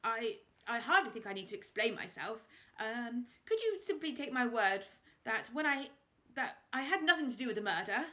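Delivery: a lightly voiced flustered lord. A narrator's reading. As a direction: bluster and stumble over the words. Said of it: "he said".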